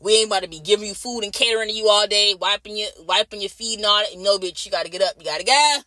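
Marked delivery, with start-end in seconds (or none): none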